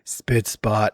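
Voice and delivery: low-pitched, glum imitation; imitated downcast AI sign-off; depressing. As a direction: drop the voice into mock gloom. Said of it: "lowered its voice and did the most depressing".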